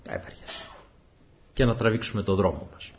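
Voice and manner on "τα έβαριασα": whispered, listless brush-off; bored shrug. Retold or imitated. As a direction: sound too lazy to bother. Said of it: "και εμείς να πούμε".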